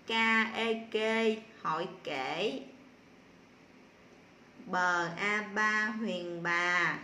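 very slow, drawn-out letter-by-letter sounding out; spelling aloud. Shown as a demonstration. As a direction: sound out each syllable for pupils to follow. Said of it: "chúng ta cùng nhau đánh vần". (đánh vần chậm, kéo dài từng âm)

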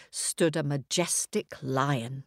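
ordinary delivery, nothing marked

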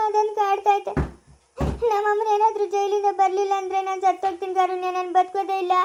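sobbing regret, catching breath between phrases; tearful self-blame. Acted. Daughter blaming herself for her mother's jailing, crying. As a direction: wail out your guilt through tears. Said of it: "ಅಳ್ಬಾರ್ದು".

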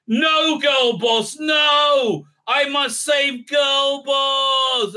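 high, loud mock cry of despair; hammed-up anguished shout. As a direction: overdo the heroic scream, slow at the end. Voicing hero's overblown grief.

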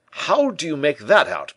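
high, loud, incredulous question; astonished. A narrator's reading. Porthos questions Moliere's claim, loudly amazed. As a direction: ask in a big, amazed character voice.

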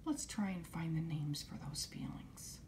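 gently whispered reply; a trusted adult, hushed and calm; soft reassuring whisper. Retold or imitated. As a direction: whisper it like a kind grown-up. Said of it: "let the person know".

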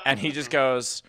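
usual speaking voice

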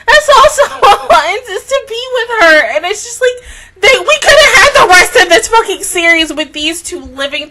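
high strained laugh-cry; half laugh, half sob; laughing through tears. Real, not acted. Upset rant about lost ending.

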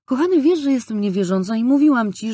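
high, loud appeal to her fiancé; an exasperated appeal to her fiancé; heated plea. A narrator's reading. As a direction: burst out at him insistently. Pleads with fiancé.

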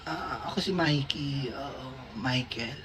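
drowsy, soft and slow, just waking from anesthesia; slow sleepy murmur, barely there; groggy mumble. Retold or imitated. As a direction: mumble your name half asleep. Groggy self after surgery.

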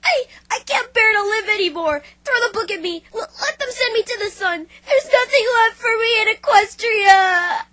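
stammering through tears, breaking into a cry; sobbing despair. Acted. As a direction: weep out the self-pity. Rainbow Dash sobbing in despair.

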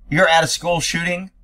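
ordinary talk

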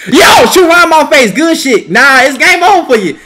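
loud trash talk. Real, not acted. Gamer taunting opponent before defending, loud trash talk. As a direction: dare him to shoot.

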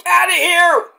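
high, loud angry yell; angry shout. Retold or imitated. Trick yelling at Melo to get out, loud and angry. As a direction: chase him off with a yell. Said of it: "And Trick is like".

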